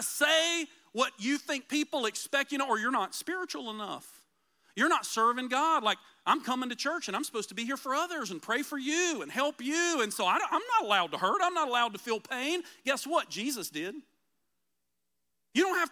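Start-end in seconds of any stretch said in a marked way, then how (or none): none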